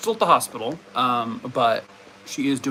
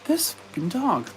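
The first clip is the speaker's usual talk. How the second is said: usual speaking voice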